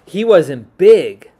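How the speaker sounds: loud, slow, stretched big; loud slow narration; emphatic big. A narrator's reading. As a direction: boom out the word big slowly.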